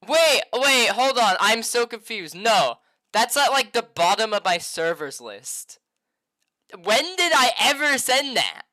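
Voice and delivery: high, loud, flustered rush; loud confused panic. Real, not acted. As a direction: sound totally baffled and loud. Confused about a sent DM.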